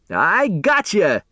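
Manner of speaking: put-on high, slow, far-off old man's crow; gleeful gotcha. A narrator's reading. Otis springing out at the tired runner, high and gleeful. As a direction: pounce on him with a cackling old man's glee. Otis Amber jumps out at Doug.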